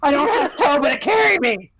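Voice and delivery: high, whiny winded plea; comic high-pitched exhausted complaint; out of breath. Acted. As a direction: wail it while winded. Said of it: "I'm out of breath".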